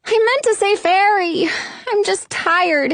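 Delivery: high, pleading excuse; distressed child explaining in a high voice; cried out. A narrator's reading. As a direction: plead the mistake, upset. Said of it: "I cried".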